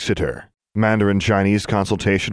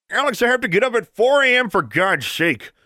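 shouted through a wall. Acted. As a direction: yell at her from the next room. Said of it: "her stepfather shouted through the wall".

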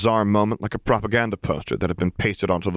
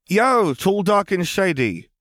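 put-on high, bright girl's call, loud; a young girl hailing a stranger; 12 semitones higher, 3 dB louder, slower than usual. Young girl calls to the vampire, high and loud.

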